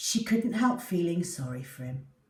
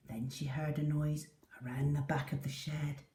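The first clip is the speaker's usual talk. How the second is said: ordinary delivery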